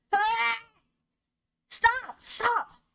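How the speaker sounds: high desperate cries of stop; pained screaming. Acted. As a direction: scream in agony. Gregor screaming in pain.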